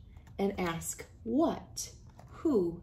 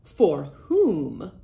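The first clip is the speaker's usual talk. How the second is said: a short, louder clipped reply; fox; 3 semitones lower, 8 dB louder than usual. Acted. Fox answers the worm, a louder sly reply. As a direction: toss it back like a sly fox. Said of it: "replied the fox".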